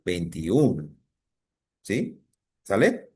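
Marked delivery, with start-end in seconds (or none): 0.0-1.0 "veintiún" with a long, stressed final Ú. Shown as a demonstration. Teacher explains the accented vowel, "veintiún" drawn out on Ú. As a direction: let the Ú of "veintiún" carry the word. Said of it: "se acentúa la vocal U".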